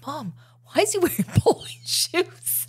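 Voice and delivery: put-on high, laughing child's question; a giggling kid asking; little girl voice. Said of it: "she said".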